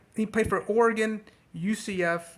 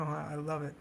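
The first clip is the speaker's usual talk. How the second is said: ordinary talk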